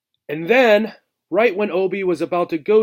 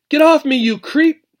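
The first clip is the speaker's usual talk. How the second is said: high, loud frightened cry; terrified cry; 11 semitones higher, 8 dB louder than usual. Acted. Obi, pinned by a stranger mouse, crying out high and loud in fright. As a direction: shriek it out, scared stiff. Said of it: "she cried".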